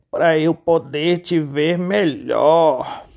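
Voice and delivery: slow, drawn-out sly wolf voice; wolf answering with false sweetness; wolf as grandma. Acted. Lobo disfarçado. (lobo disfarçado, fala arrastada)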